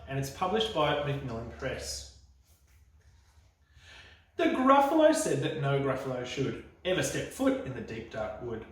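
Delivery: usual speaking voice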